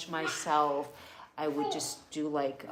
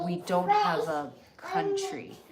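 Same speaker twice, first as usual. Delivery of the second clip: higher and slow, stressed; emphatic repeat; 10 semitones higher than usual. Real, not acted. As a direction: lean hard on the repeat.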